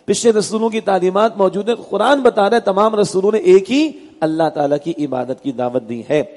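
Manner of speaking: usual speaking voice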